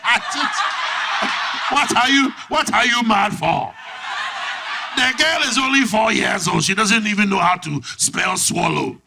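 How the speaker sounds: put-on loud, high accented protest; African dad. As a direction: defend yourself as an exasperated father. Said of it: "The dad was like".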